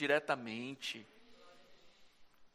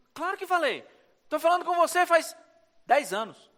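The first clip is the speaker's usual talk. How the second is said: high, a touch exasperated; voice of God, exasperated; 10 semitones higher, 3 dB louder than usual. Retold or imitated. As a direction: answer like a patient father. God answering child. (Deus respondendo, um pouco impaciente)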